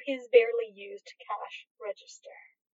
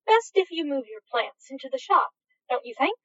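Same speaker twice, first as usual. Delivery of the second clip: usual speaking voice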